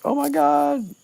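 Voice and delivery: high, loud mock outburst; excited exclamation. Wood reacting to the plan, excited exclamation. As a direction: blurt it out, hammed-up and high.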